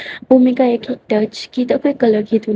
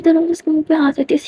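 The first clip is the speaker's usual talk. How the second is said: usual speaking voice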